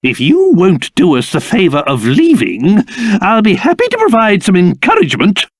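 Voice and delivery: ordinary talk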